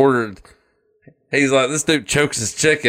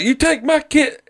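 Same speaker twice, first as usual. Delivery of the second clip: much higher, strained cartoon dad voice; mock angry dad. Retelling a cartoon, the dad confronts the boys, in a high mock-angry voice. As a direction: squeal it like an outraged cartoon father.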